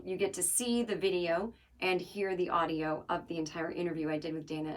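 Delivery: ordinary talk, nothing marked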